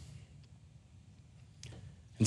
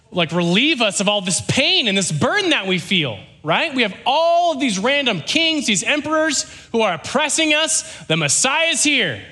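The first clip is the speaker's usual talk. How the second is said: high, fervent crowd voice; acted excitement; 11 semitones higher than usual. Israelites hail their rescuer from Rome, acted excitement. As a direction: proclaim it like an oppressed people, pitched up. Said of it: "they're blowing their minds".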